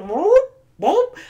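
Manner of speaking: high squeaky helium-voice whoop. Retold or imitated. Friend on helium imitated with a high squeak. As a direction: chirp it like you just breathed helium. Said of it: "She just goes".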